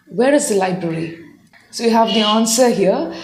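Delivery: ordinary talk, nothing marked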